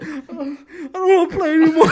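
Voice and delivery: high, fast whiny mock crying; mock whimper. Injured teammate tells the team he quits, mock crying. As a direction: whimper like a hurt kid quitting. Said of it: "He's like".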